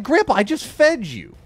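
high, loud exasperated complaint. Real, not acted. Gamer to hungry grandpa, exasperated protest. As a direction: protest at an unreasonable demand.

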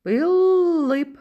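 Л in "Пилип" held long, high and loud; "Пилип" with the Л drawn out to single out the sound; middle consonant stretched. Shown as a demonstration. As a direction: hum through a long Л inside "Пилип". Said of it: "Наступний звук у слові".

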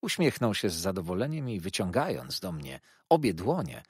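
plain reading voice

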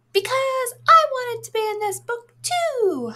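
cheerful little spot cutting in; Happiness Spot voice. Acted. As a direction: pop in brightly as the eager spot. Second spot character butts in.

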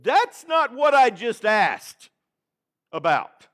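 a hint of a puzzled Nicodemus; Nicodemus thinking. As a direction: voice a baffled Nicodemus pushing back. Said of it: "to think".

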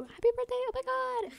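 high, loud squeal; excited. Retold or imitated. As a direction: squeal it like a text. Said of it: "I texted you".

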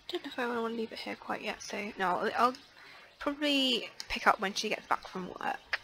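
ordinary talk

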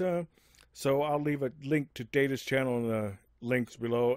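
usual speaking voice

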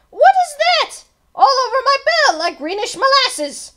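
very high, loud squawk of horror; aghast outcry. A narrator's reading. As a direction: exclaim in shock as the bell ringer. Bell ringer aghast at gummed bell.

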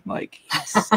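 whispered gleeful yes; a hushed, delighted yes; mock excited seller. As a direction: imitate the wholesaler's quiet glee. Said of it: "he must be so freaking excited".

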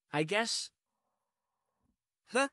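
normal talking voice

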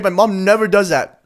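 ordinary talk, nothing marked

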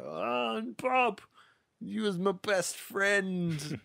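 hammed-up wail, high and drawn out slowly; mock grief. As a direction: overplay the heartbroken cry for a laugh. Mock grief over a dead friend.

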